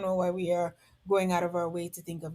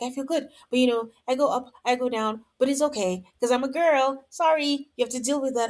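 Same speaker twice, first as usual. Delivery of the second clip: imitating Courtney; 5 semitones higher, 4 dB louder than usual. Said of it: "And she's like".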